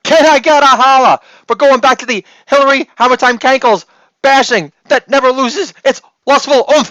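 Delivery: high, loud hype-man call; hype holla. Acted. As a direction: pump up the crowd like a hype man.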